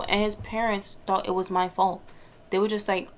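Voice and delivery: usual speaking voice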